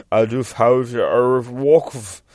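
loud, drawn-out backwards demon speech; demonic gibberish. Retold or imitated. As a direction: garble it like a possessed child. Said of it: "I think it's demonic backwards".

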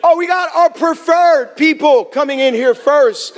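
mock the preferred boarding with a sneer; mock indignation. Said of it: "Excuse me if I sound a little bit angry".

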